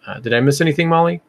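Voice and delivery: usual speaking voice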